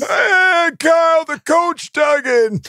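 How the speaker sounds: very high, screamed podcast welcome; hyped show intro. Acted. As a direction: yell the welcome like a hype man, drawing words out. Shouted co-host introduction.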